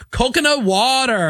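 lightly imitated kid sing-song; daughter's meme line. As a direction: mimic the daughter doing the meme. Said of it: "What is this?".

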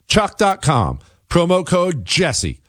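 high-pitched, hammed-up sales read; sponsor ad pitch. Host selling Chalk supplements to listeners, high-pitched salesman patter. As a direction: stretch the web address out high, like a jingle. Repeats ad web address and code.

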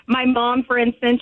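normal talking voice

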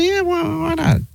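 soft, squeaky-high casual agreement; high-voiced retelling. Husband answering his wife's question, high and offhand. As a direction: toss it off in a high, small voice.

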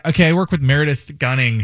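normal talking voice

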